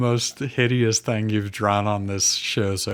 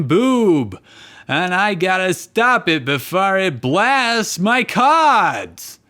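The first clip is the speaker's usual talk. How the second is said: raised, strained duelist voice; loud, higher cartoon duelist; Joey voice; 8 semitones higher, 4 dB louder than usual.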